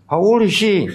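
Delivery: loud, high-pitched question to the crowd; calling out a quiz. Real, not acted. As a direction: throw the question out to the congregation. Quizzes congregation aloud.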